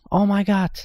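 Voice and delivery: high, astonished exclamation. Real, not acted. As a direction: blurt it high, straight after a gasp. Shocked reaction after a gasp.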